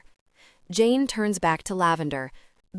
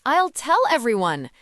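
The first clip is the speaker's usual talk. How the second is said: louder, higher threatening shout; 6 semitones higher, 5 dB louder than usual. Acted. Ravi threatens the thief Lavender with a loud shout. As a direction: yell the threat at her.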